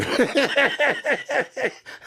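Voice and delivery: squealing laugh breaking through; high laugh. Real, not acted. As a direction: laugh mid-sentence, high. Laughing mid-sketch.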